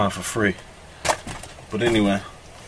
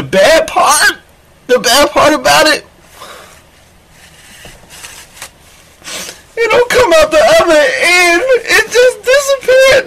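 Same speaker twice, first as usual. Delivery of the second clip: hammed-up crying shout, much higher and louder; mock wailing; 14 semitones higher, 6 dB louder than usual. Man fake-sobbing to listeners about his dick, hammed-up wail. Comic breakdown over lost dick.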